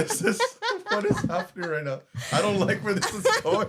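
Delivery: high, loud laughing protest; emotional, flustered laughing. Real, not acted. Caught getting emotional on the podcast, flustered laughing. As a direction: laugh through unexpected emotion, voice jumping up. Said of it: "I'm starting to get emotional right now".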